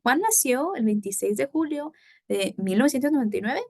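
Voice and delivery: brisk question, rising through to "nueve"; puzzled face, quick lift at the end of "nueve"; questioning intonation. Shown as a demonstration. Teacher showing the face of asking a question, questioning intonation. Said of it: "I'm asking a question".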